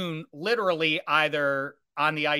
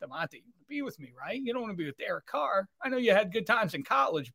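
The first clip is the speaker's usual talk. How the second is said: mock coaxing; 5 semitones higher, 5 dB quieter than usual. Rodgers coaxing Davante.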